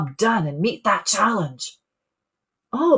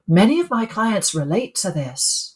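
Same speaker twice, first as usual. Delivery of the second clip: ordinary talk, nothing marked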